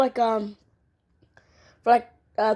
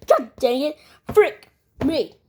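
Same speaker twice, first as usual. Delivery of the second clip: ordinary talk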